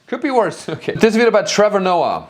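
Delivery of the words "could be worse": a lighter repeat of mom's verdict; lighter mock mom. Streamer repeating his mom's line, lightly mocking. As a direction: toss it off again, mildly mocking. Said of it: "she told me that I could be worse as a son".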